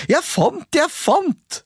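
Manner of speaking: high, eager sing-song call; a boy crowing over his find. A narrator's reading. Askeladden calling to his brothers, high excited cry. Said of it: "ropte han".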